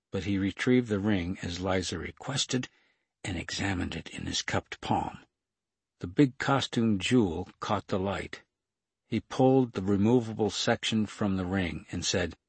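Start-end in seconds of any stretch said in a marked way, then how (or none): none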